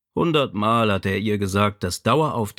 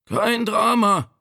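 high, drawn-out weak voice; 11 semitones higher, slower than usual. A narrator's reading. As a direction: feeble call from the next room. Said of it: "kam Vitos schwache Stimme".

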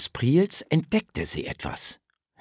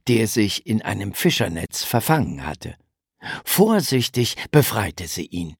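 ordinary delivery

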